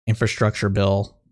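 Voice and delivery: usual speaking voice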